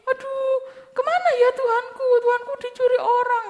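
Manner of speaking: high, crying voice of a woman in grief; tearful lament. Acted. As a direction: wail it like Maria at the empty tomb. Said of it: "Ibu Maria sedih, nangis". (ratapan sambil menangis)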